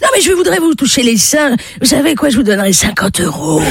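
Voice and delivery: high, insistent wheedling; imploring old man pressing his case; pleading old man. Acted. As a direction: haggle and beg, pitched high. Old man bargains, still begging.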